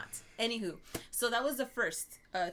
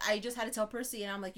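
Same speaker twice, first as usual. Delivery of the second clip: usual speaking voice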